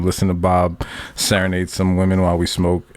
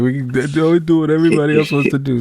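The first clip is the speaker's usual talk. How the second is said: higher, quick whiny friend; babyish whining; 6 semitones higher than usual. Retold or imitated. Friend whines to the group about plans, babyish. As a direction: put on a whiny baby protest. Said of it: "Einstein's being a baby about it".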